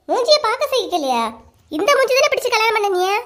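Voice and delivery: high-pitched, offended comeback; indignant retort. Acted. Wife insulted about her face by husband, indignant high retort. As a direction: throw his insult back at him, high and stung.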